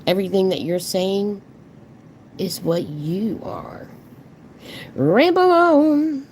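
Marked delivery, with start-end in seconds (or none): 4.9-6.3 sarcastic. Real, not acted. Mocking dismissal of speaker.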